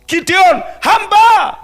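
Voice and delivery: loud, high shouted roll call; shouted preaching. Real, not acted. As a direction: hammer out each name and title at full voice. Preacher shouts out list of servants.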